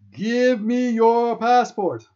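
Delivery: full-blast high, drawn-out screamed demand. A narrator's reading. Exploding guard demands the passport, screaming. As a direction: scream it slowly, stretching every word. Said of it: "He screams".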